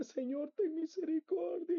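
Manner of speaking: imploring, retold outcry; retold cry. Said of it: "Yo le gritaba".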